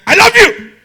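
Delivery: very loud, high-pitched shout; angry declaration. Shown as a demonstration. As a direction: yell the endearment harshly at full force. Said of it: "two different meanings".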